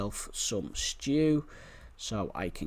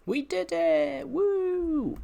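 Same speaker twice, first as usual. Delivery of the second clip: triumphant cheer; 4 dB louder, much slower than usual. Real, not acted. Reaching the island after long bridge.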